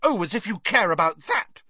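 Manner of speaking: a girl mocking Ron's motives; light scoff; scoffing Hermione. A narrator's reading. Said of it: "scoffed Hermione".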